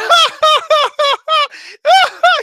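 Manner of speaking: loud, high, drawn-out mocking laugh; mocking laugh imitated. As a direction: copy his loud mocking cackle. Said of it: "he starts laughing like that".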